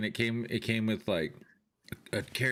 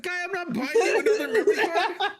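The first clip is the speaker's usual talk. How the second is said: loud, much higher, laughing no; laughing refusal; 6 dB louder than usual. Retold or imitated. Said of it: "I was like".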